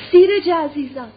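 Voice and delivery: loud, high, drawn-out endearment; emotional call. A narrator's reading. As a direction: call slowly to your beloved child, overcome. Mother greets long-missed son. (با صدای بلند، کشیده و پراحساس)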